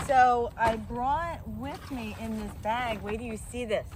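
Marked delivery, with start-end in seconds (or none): none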